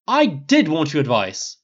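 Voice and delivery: slow, emphatic protest; defensive, stretching the words; insisting. A narrator's reading. Harry contradicting Snape, insistent. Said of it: "insisted Harry".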